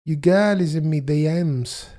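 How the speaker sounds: lightly voiced Jamaican accent; bad Jamaican accent. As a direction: try the Jamaican line again. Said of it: "I can't even do the accent".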